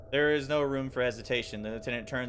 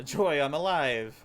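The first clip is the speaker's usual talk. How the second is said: joyful cry; 4 semitones higher, 6 dB louder, slower than usual. Acted. Character cheers surviving the shot.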